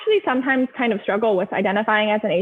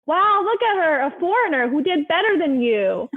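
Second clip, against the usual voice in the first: imitating a marveling mom, pitched higher; mock the amazed mothers comparing kids, voice up high; impressed auntie; 7 semitones higher than usual. Friends' moms praising the foreign girl, higher mock amazement. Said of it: "random moms of my friends would be like".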